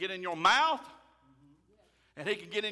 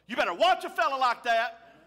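loud warning; 4 semitones higher, 8 dB louder than usual. Real, not acted. Warning the congregation.

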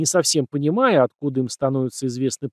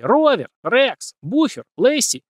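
shouting names; 9 semitones higher, slower than usual. A narrator's reading. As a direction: yell out the dog names. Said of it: "начинают орать".

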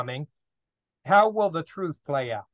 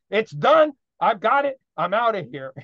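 excited getaway; 4 semitones higher, 6 dB louder than usual. Retold or imitated. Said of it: "He's like".

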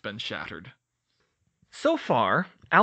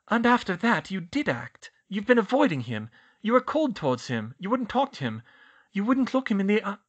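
high, loud, mounting accusation from a clever student; Hermione accusing; 8 semitones higher, 5 dB louder than usual. A narrator's reading. Student piecing it together and accusing headmaster, high insistent voice. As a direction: list his faults at him, pitched high.

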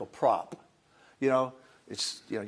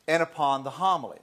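normal talking voice

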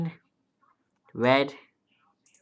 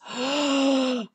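delighted gasp; 7 semitones higher than usual. Real, not acted.

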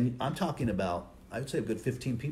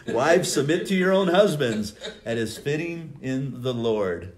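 loud scripture reading; 4 semitones higher, 7 dB louder than usual. Acted. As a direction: proclaim the verse loudly, slowing on the last line. Reads verse loudly after a laugh.

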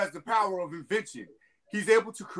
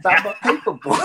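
laughing; 12 semitones higher, 12 dB louder than usual. Real, not acted. Jokes about previous life.

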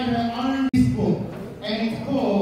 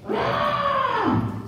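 much higher startled squawk shout; screeching alarmed chicken cry. Acted. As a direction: let out a panicked hen's squawk. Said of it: "squawked Missus Chicken".

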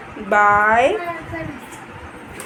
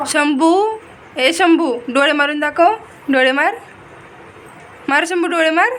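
high, raised sing-song coaxing; 7 semitones higher than usual. Real, not acted. Mother coaxing her baby son to wink for the camera, high sing-song.

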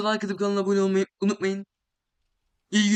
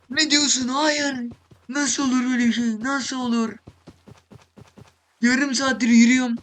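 higher, louder cry of disbelief; dramatic disbelief; 4 semitones higher, 3 dB louder than usual. Real, not acted. Gamer realizes he looped back to the same spot, high dramatic disbelief. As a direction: wail it like betrayal, nasıl olur. Lost again after half hour walking.

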